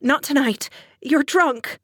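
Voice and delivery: high, nervous protest; frightened wife holding him off; shaky. A narrator's reading. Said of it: "I said shakily".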